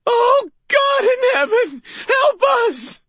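put-on high, loud, slow crying old woman; anguished cry. Fallen landlady screams to heaven, high and crying. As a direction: wail it out in pain and despair. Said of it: "She screamed, agony in her quavering voice".

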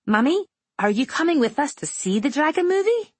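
put-on high, sweet child voice; little girl voice. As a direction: play a small girl making up.

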